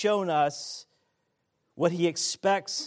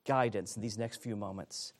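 ordinary talk, nothing marked

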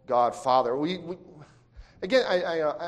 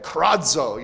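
punchy raised cry; yelled Greek word; 5 dB louder than usual. Shown as a demonstration. Preacher showing how the Greek word sounds, cried out. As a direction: throw the word out like a yell. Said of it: "even sounds like that".

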